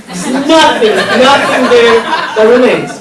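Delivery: high-pitched comic lament; exasperated rant. Acted. Comedian lamenting to the audience that nothing remains, exasperated and high. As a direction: pitch up and lean on each NOTHING. Comedian ranting about losing everything.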